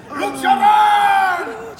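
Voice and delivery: high, loud, slow shout; shouting referee. Shown as a demonstration. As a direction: bellow it like a wrestling announcer. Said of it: "he talked like this".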